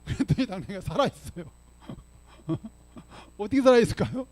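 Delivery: telling it through real chuckles; tickled, laughing storyteller; amused laughing. Real, not acted. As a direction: laugh as you reveal the surprise. Laughs telling the donkey survived.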